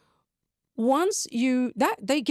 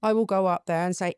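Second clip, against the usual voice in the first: ordinary delivery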